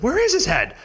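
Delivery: retold high question, is stressed; frantic chorus. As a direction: imitate them all asking, high. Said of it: "they all said".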